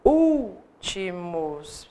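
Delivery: últimos split slowly into syllables, úl-ti-mos. Shown as a demonstration. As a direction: stretch últimos out beat by beat. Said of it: "se vocês forem separar a sílaba". (últimos dito devagar, sílaba por sílaba)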